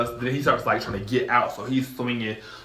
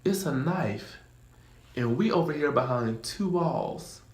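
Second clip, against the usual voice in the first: unimpressed; 5 semitones higher, 5 dB quieter, slower than usual. Retold or imitated. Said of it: "I was like".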